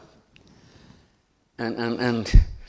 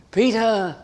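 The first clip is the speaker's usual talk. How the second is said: higher, louder call of the name; 10 semitones higher, 5 dB louder than usual. Acted. Jesus calling to Peter, loud and high.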